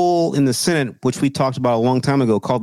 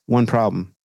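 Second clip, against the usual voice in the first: ordinary delivery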